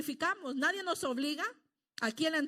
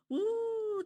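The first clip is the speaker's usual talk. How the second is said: moved exclamation; 8 semitones higher than usual. Acted. Mature believer moved to praise.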